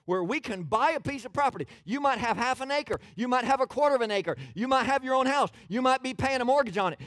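high, loud preacher's list, driven hard; full-voiced pulpit crescendo; impassioned preaching. Real, not acted.

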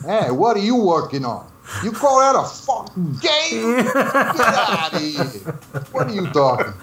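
hammed-up, drawn-out New York ribbing; New York accent. Teasing Bob about his game, hammed-up New York accent. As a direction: razz a coworker in broad, high-pitched New York. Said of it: "I'd start doing my New York accent".

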